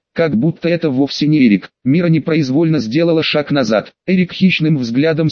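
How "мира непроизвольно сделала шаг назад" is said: ordinary delivery, nothing marked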